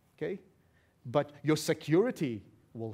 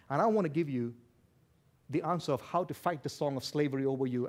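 ordinary talk, nothing marked

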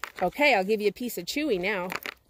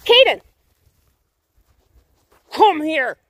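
much higher, loud yell; loud call to the dog; yelling a name; 8 semitones higher, 9 dB louder, slower than usual. Real, not acted. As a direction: belt the name out. Calling dog from afar.

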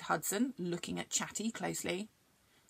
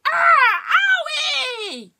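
sudden high loud cry of pain; pained yell; 16 dB louder, slower than usual. Acted. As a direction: yell out as your paw gets hurt. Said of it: "sounded angry with a yell".

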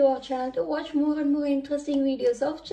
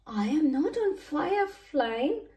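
raised-pitch grumble; mildly put-out storybook complaint; grumbling; 5 semitones higher, slower than usual. A narrator's reading. Soaked Gordon complains to the fire engine, grumbling. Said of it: "Gordon grumbled".

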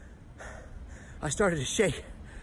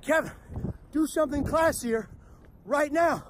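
"classier" pitched up high, urgent; the brain's order, now higher and pushier; panicked inner voice; 6 semitones higher than usual. Retold or imitated. His brain urging him mid-disaster, higher and urgent. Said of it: "my brain reacted again".

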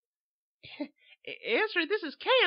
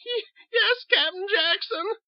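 very high, tearful plea; pleading; 9 dB louder than usual. Acted. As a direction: beg him to understand. Cowed sister.

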